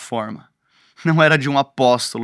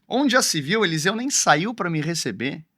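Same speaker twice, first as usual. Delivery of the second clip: put-on affronted complaint, pitched up; offended; 3 semitones higher than usual. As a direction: act out Naamã taking offence. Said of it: "Naamã ele fica ofendido".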